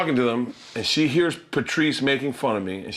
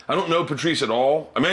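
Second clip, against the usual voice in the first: ordinary delivery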